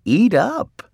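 high, sing-out urging, lightly acted; lightly voiced sleep-talking wife. A narrator's reading. Missus Watson feeding Mercy in her dream, lightly voiced urging. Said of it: "Missus Watson said in her sleep".

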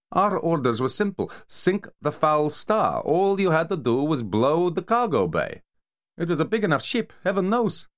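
high, scornful mafia enforcer voice; Kamar berating. Acted. Kamar mocking Vasikin for botching the sinking, high scornful rant. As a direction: sneer at the failed sharpshooter, pitched high.

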